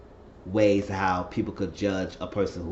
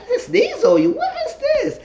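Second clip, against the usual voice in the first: a giggly high mock voice; high laughing imitation; 6 dB louder than usual. Imitating him after sneezing on her, high laughing voice. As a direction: squeal it high, laughing through it.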